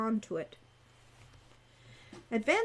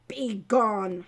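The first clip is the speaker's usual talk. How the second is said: louder curt dismissal; snapped; 6 dB louder than usual. A narrator's reading. Angry centaur sending Wren away, snapping. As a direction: bark the dismissal. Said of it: "Heinrich snapped".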